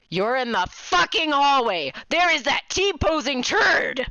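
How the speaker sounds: loud, high outburst at the screen; excited yelling. Real, not acted. Player shouting at the enemy on screen, loud and high. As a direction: yell it out at the game.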